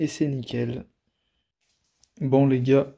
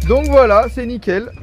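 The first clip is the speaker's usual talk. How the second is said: excited; 9 semitones higher, 8 dB louder than usual. Real, not acted. Showing the sensors working outdoors.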